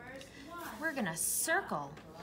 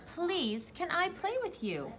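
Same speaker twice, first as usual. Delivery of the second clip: speaking up, a bit higher; loud voice; 7 semitones higher than usual. A narrator's reading. Goat finally asking Piggy properly, mild speaking-up voice. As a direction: ask boldly, a touch of confidence. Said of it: "he said to Piggy in a loud voice".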